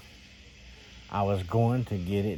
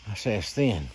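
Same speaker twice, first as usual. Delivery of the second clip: ordinary talk, nothing marked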